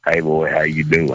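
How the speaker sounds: a good-old-boy Southern hello, lightly played; Southern accent. Shown as a demonstration. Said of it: "what my southern accent sounds like".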